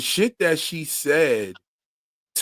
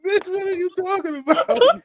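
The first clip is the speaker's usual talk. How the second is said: mock outraged retort; 12 semitones higher than usual. Retorting to the character.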